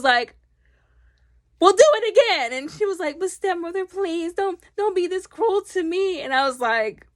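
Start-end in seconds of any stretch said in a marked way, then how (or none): 1.6-2.5 higher, louder mock-imperious command; stepmother's order. 3.2-6.2 higher pleading voice; pleading daughter. Retold or imitated.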